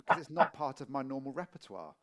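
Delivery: usual speaking voice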